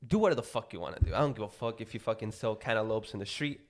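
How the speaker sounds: ordinary delivery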